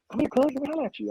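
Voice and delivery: high, casual street hustler voice; drug dealer's call. Retold or imitated. A Skid Row drug dealer calling to a runner, high and easygoing. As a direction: sweet-talk the runner into stopping.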